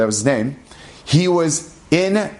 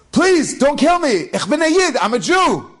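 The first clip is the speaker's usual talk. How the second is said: terrified pleading; 12 semitones higher than usual. Retold or imitated. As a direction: beg for your life in a high voice. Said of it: "his whole body's shaking".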